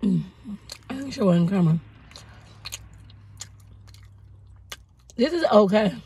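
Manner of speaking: eating while speaking; talking while chewing. Real, not acted. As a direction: mouth partly full of donut. Seen 3: Bit donut at -5; jaw working, holding donut pieces while speaking.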